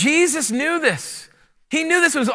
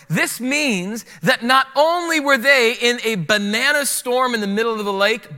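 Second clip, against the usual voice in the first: ordinary delivery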